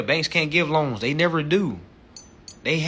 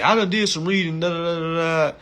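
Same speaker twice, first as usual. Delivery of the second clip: mock know-it-all voice, pitched higher and louder; mocking quote; 5 semitones higher, 6 dB louder than usual. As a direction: sneer at the self-taught crowd. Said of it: "a lot of people say".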